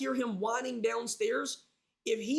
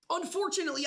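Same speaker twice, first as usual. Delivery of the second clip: ordinary talk, nothing marked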